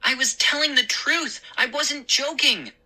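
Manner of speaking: high, eager vindication; happy cry. Acted. Boy proven right to father, happy and high. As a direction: insist joyfully that you were honest. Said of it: "I cried happily".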